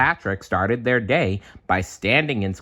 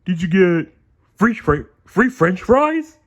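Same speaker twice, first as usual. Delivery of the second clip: loud, high, dopey starfish voice; Patrick voice; 11 semitones higher, 8 dB louder than usual. A narrator's reading. Patrick guessing at SpongeBob's news, loud dopey voice. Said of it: "Patrick asked".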